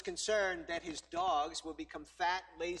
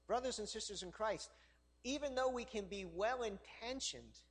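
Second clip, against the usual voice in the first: usual speaking voice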